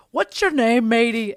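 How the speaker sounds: mock hearty pirate; pirate host. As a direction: imitate a pirate hailing a kid. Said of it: "they're like".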